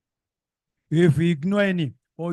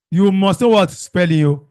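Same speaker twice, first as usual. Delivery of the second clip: scolding; 3 semitones higher, 8 dB louder than usual. Real, not acted. Teacher scolding class.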